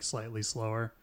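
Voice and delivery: ordinary delivery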